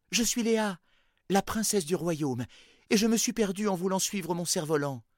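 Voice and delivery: high, bright-eyed princess voice; lightly voiced young princess. A narrator's reading. Princess answers at the door.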